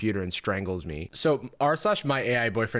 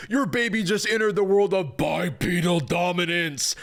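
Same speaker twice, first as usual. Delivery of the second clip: high mock-epic announcer hype; over-the-top gushing proclamation; hyped-up chatbot; 4 semitones higher than usual. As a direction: proclaim it like a grand triumph. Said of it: "G P T four oh".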